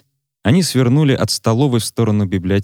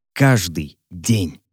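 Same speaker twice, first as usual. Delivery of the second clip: sinister muffled voice; 2 semitones lower, much slower than usual. A narrator's reading. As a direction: drag each word out as a threat. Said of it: "приглушённый зловещий голос".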